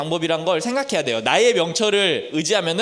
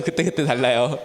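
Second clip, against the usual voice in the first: "그때그때" lower, in a different put-on voice with a lifting end; put-on catchphrase voice; 6 semitones lower than usual. Shown as a demonstration. Teacher echoing a familiar catchphrase in a borrowed voice. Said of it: "네, 맞아요".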